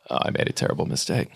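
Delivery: regretful whisper. Retold or imitated. Ex voicing the realization they regret the breakup, in a whisper. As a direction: whisper it like a sheepish confession.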